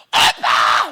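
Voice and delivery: a high, excited yell of greeting; loud shout. Acted. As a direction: holler for grandpa at full volume. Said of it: "Coba teriak".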